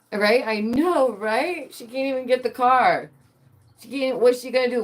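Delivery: high excited indignation; rally the chat, pitch way up; animated. Real, not acted.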